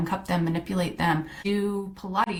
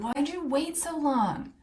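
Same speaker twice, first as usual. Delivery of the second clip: higher, slow self-scolding question; scolding herself; 7 semitones higher, slower than usual. Retold or imitated. Kicking herself for waiting so long, slow scolding. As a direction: chide yourself for waiting, drawn out. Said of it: "kicking myself and being like".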